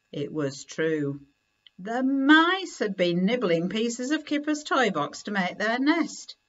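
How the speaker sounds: ordinary delivery, nothing marked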